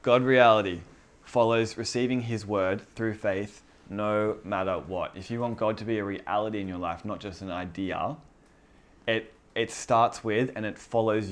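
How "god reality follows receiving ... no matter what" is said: slow deliberate recitation. Shown as a demonstration. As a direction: spell it out slowly so they can write it down. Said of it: "One more time. From the top".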